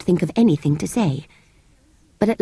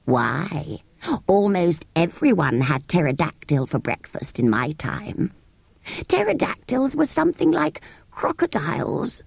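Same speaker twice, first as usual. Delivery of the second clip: put-on old sand fairy; 3 semitones lower than usual. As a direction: reminisce like a creature from long ago. Psammead tells of old times.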